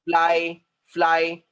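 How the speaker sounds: फ्लाई said firmly, ringing out the final "eye" sound. Shown as a demonstration. Teacher shows class the hidden vowel sound, sounding out फ्लाई with a strong "eye". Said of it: "आई का sound आ रहा है".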